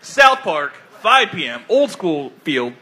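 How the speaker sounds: score announcer. Acted.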